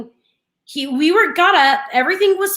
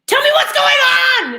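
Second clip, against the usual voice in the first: high yelled demand, louder; yelling; 12 semitones higher, 5 dB louder, faster than usual. Retold or imitated. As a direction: burst out shouting at him. Mom finally yells at son.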